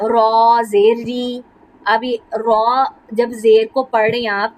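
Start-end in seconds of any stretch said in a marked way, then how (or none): 0.1-1.4 ری said thin and light, very slowly. Shown as a demonstration. Teacher showing the thin ری with zer. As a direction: keep ری thin, drawn out slowly. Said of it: "زیر جو ہے وہ باریک رہے گی".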